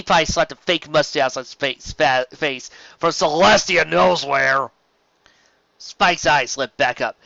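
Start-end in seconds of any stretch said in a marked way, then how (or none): none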